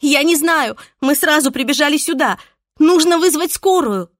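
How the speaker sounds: high, loud, breathless and flustered; shaken tearful witness. Acted. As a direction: blurt it out in a panic. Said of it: "сбивчиво рассказывала взъерошенная девушка".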